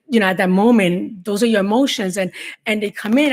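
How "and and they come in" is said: normal talking voice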